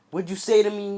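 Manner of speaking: high, loud confrontational question; affronted challenge. Retold or imitated. Answering a rival who called him nerd, affronted. Acted quarrel with Bakugo.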